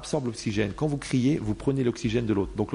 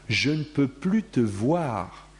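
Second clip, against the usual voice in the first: drawn-out disdain; slow contempt; much slower than usual. Retold or imitated. People who refuse to see you, slow and contemptuous. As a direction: lean on every word with contempt. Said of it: "des gens qui te disent".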